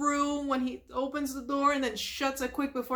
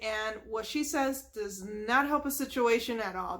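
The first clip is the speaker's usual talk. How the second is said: ordinary talk, nothing marked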